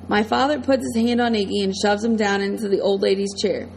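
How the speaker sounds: usual speaking voice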